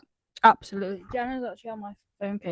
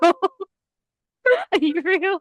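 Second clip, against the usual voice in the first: laughing; 6 semitones higher, 10 dB louder, much slower than usual. Retold or imitated. Repeating it through laughter.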